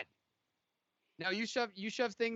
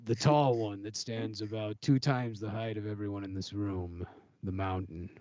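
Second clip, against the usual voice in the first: low, ominous warrior voice; ominous fighter voice; 10 semitones lower than usual. Acted. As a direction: brood over it like a grim gladiator. Gladiator character voice.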